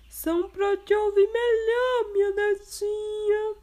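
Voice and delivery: wolf disguising voice. Acted. Wolf posing as grandma, disguised voice. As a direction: pass yourself off as grandma.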